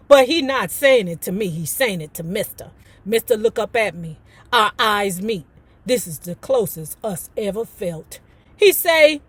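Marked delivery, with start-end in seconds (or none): none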